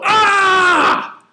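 high, drawn-out cry of pain; imitated pained scream. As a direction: imitate a friend screaming after being hit. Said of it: "I hear the big dog go".

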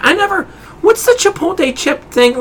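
high-pitched imitation of a dithering customer; put-on high customer voice; mimicked clueless customer.

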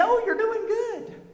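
slow, soft, high mock-sweet nurse voice; imitating the hospital staff's chirpy praise; cheery nurse. Retelling nurses cheering his weak breathing, sing-song mock praise. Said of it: "and they say".

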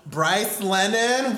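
host's big welcome, long on the name; guest introduction. Shown as a demonstration. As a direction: announce the name slowly, lifting it up. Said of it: "We would like to introduce our guest for tonight's show".

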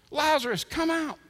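high-pitched mock command, short of a shout; incredulous echo. Onlookers echoing Jesus's order to Lazarus, incredulous. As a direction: parrot the command in disbelief. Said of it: "What is he thinking?".

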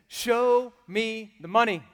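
slow, loud, drawn-out "Show me the money". Retold or imitated. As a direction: belt the line out slowly. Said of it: "I want you to say something".

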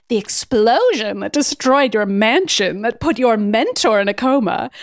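hammed-up theatrical villain; Celine. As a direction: declaim it with menacing relish, stretching key words. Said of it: "And Celine is like".

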